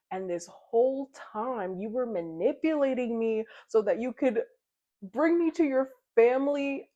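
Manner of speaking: starts slow and tight, builds to a loud, high yell; furious outburst. Retold or imitated. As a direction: let the betrayal boil over into shouting. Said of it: "She's like".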